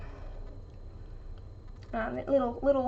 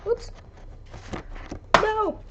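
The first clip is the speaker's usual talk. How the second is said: alarmed cry; 11 semitones higher than usual. Real, not acted. Sudden mishap, something falling.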